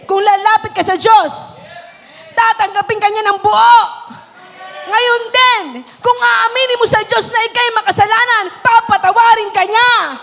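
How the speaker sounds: fiery revival preaching; preaching at full force. Acted. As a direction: preach it to the rafters over music.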